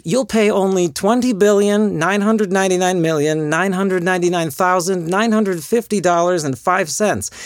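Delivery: pushy, upbeat sales pitch; home shopping host. Acted. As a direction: hawk the deal like shopping TV. Pitching deals and prices.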